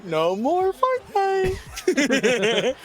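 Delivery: laughing quote. Retold or imitated. Kid told to stop gaming.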